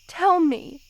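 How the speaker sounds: a desperate, pressing demand; urgent demand. Acted. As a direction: insist on an answer. Demanding answer from beloved.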